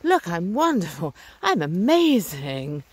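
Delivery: drawn-out, showy mock bragging; mock boasting. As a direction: show off with exaggerated self-importance, stretching the words. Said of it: "being something about my ego".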